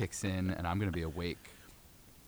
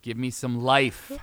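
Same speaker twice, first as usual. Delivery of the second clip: slow, loud, raised plea; begging; 5 semitones higher, 9 dB louder, slower than usual. Pretend. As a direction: drag out a mock-desperate appeal. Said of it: "we'll both beg the universe".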